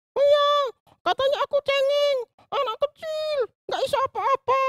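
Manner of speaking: put-on very high child puppet voice, telling her woes; a small girl explaining why she is sad; Susan puppet voice. Puppet girl Susan tells the pastor what the other kids said, in a very high voice. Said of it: "ini namanya Susan".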